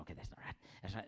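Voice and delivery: whispered, under the breath; muttering to himself. Retold or imitated. Pastor retelling muttering at the TV, whispered. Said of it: "I was sitting there going".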